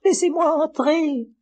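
very high, slow little-lamb voice; wolf fakes a pitiful lamb; tiny voice. A narrator's reading. Wolf disguised as lamb begs, tiny high slow voice. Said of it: "dit le loup d'une toute petite voix".

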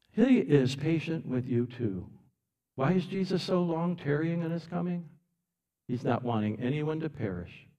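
ordinary talk, nothing marked

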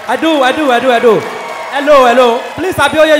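loud, pleading protest to the host. Real, not acted. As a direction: shout to be heard over the room. Guest protesting over the laughter.

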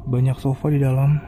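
ordinary talk

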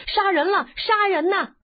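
high-pitched cry of murder; loud yelling. A narrator's reading. Boy rolling away from the blade, yelling murder. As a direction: yell out in mock panic, pitched high. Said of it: "大叫".

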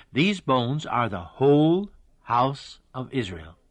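slow, weighted stress on "whole house"; "whole" drawn out for emphasis; emphatic scripture reading. Real, not acted. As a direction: read it deliberately, leaning on "whole house of Israel". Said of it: "I'm emphasizing that".

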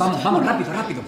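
loud, high urging; urgent hurry. Acted. Father rushes the girls to fetch the adoption papers, loud urging. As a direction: push them out the door fast.

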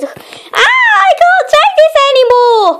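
high, fast exasperated cry; fed-up outburst. Acted. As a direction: at the end of his tether.

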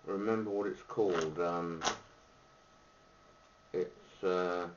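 talking around pipe stem; speaking through clenched teeth; pipe in mouth. Real, not acted. Seen 2: Pipe clenched in teeth from 2.8s, lit from 5.8s onward.

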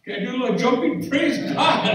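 high, loud ecstatic praise; rejoicing preacher, whooping; preaching at full force. Real, not acted. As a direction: shout the church into joy.

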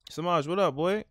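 high, loud call-out; loud greeting. Pretend. Player calls out to Samaj across the gym, loud and high. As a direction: shout hello across the gym.

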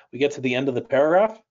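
fast skim through the paragraph end; quick demo reading pace; sped-up reading. Shown as a demonstration. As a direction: rush through to show speeding up. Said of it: "Now I'm gonna speed up".

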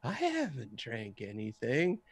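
slow, soft tipsy denial; drunk dude. Retold or imitated. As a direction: deny it quietly, dragging the words like a drunk. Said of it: "acted like a drunk dude".

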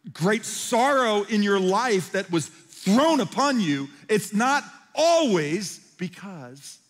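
slow right down and lean on every word; slow emphatic preaching. Acted. Pastor stresses that sorrow is not punishment, slow and loud.

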